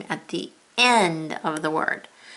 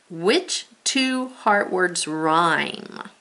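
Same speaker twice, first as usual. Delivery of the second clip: very slow, each word spaced out; slow read-along; much slower than usual. Shown as a demonstration. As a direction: read it slowly with the class. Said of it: "Read with me".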